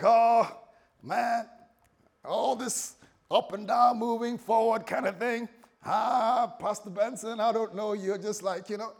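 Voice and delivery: loud, high American impression; American accent. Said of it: "with my American voice".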